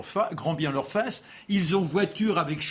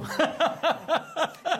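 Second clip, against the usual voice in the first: laughing; 10 semitones higher than usual. Real, not acted. Panel laughter.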